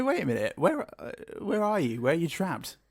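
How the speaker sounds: high puzzled questioning; baffled quote. Retold or imitated. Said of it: "And you're like".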